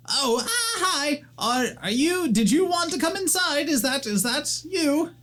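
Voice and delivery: very high, hurried panicky voice; young gnome caught without pants, flustered. Acted. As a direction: scramble to stall the visitor.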